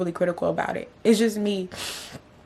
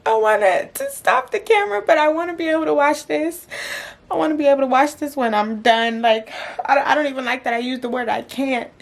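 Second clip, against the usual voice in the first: raised, strained crying pitch; tearful; 5 semitones higher than usual. Real, not acted. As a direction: talk on through real tears. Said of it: "I think I just broke down crying".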